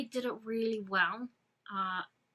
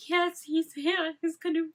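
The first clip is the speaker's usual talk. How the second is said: thrilled quote; 9 semitones higher, 8 dB louder than usual. Retold or imitated. Fan quotes her cinema reaction, high and thrilled. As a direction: savor each word like a dream come true.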